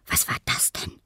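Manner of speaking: hushed whispered question; curious whisper. A narrator's reading. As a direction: whisper as if not to be heard. Paul peeks in, startled. (leise geflüstert)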